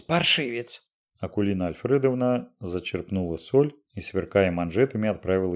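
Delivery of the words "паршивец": angrier repeat. A narrator's reading. Cursed repeat, higher.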